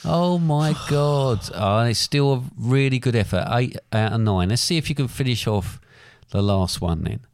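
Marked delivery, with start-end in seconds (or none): 0.0-1.4 exasperated sigh. Real, not acted. Reacts to the wrong answer.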